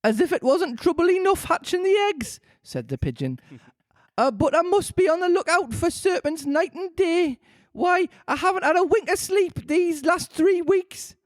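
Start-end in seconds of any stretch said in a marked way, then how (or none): 0.0-2.4 pigeon complains about serpents; high pigeon voice. A narrator's reading. 4.2-11.1 pigeon complains about serpents; high pigeon voice. A narrator's reading.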